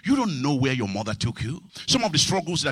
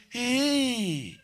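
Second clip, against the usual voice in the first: skeptical hey; 6 semitones higher than usual. Retold or imitated. Skeptical reaction to the quote.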